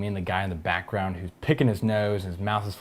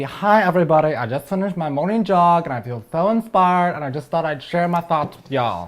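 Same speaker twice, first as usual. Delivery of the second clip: higher, bubbly inspired vlogger voice; perky vlogger; 6 semitones higher, 6 dB louder than usual. Retold or imitated. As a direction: gush like a jogger vlogger. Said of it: "The walk and talk video".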